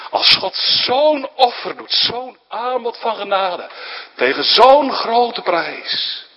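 preacher pleading not to cast aside grace; impassioned preaching. Acted. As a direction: plead with a catch in the throat. Impassioned plea about God's offer.

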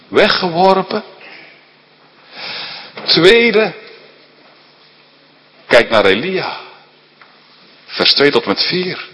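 luid en hoger, preken op volle kracht; vurig preken. Acted. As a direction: preek het vurig de zaal in. Preacher at full force. (vurige preekstem)

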